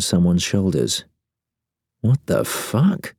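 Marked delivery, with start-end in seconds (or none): 2.0-3.1 slow, groggy bafflement with rising pitch; fuzzy bewilderment. A narrator's reading. Man waking beside someone unexpected, fuzzily bewildered. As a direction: puzzle it out slowly, pitch climbing. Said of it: "I think fuzzily".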